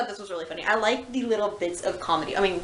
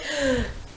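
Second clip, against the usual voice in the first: short higher gasp of realization; realizing gasp; 5 semitones higher than usual. Retold or imitated. The sister realizes she fell for the trap, gasping. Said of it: "realizes that she fell into his trap".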